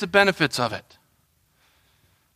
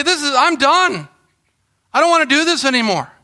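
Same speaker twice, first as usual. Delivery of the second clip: fed up; 8 semitones higher than usual. Acted. Acts out quitting on God.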